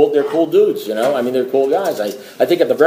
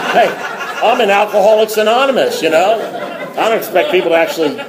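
laughing; 5 semitones higher than usual. Real, not acted. Amused aside about the kid.

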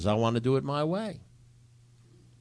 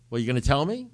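louder, higher retold question; impatient demand; 4 semitones higher, 8 dB louder, faster than usual. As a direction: press hard for an answer. He presses sponsor for the answer.

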